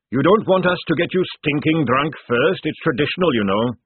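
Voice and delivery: loud, booming fat sergeant; hearty sergeant. Acted. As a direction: boom it like a jovial recruiter. Sergeant recruiting pitch.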